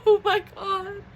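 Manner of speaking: high, tearful laugh of disbelief; half laugh, half sob, pitched high; crying with joy. Real, not acted. Fan who just bought tickets, laughing and crying. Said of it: "because I'm crying".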